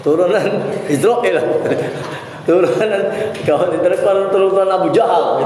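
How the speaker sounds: mock angry insult with laughter. Shown as a demonstration. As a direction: scold the pupil half laughing. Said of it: "kalau aku marah macam itu".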